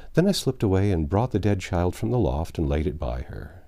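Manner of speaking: plain reading voice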